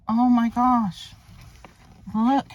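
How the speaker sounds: ordinary talk, nothing marked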